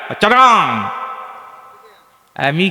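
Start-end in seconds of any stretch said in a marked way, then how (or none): 0.1-0.9 higher, Chittagong dialect cháraŋ for চট্টগ্রাম; Chittagong accent. Retold or imitated. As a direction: mimic the boy's Chittagong accent. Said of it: "বাড়ি কোথায়?". (চট্টগ্রামের টানে "চারগাং")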